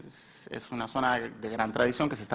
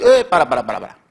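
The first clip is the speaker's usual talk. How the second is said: interrupting call; 11 dB louder, faster than usual. Retold or imitated. Everyone interrupts the singer.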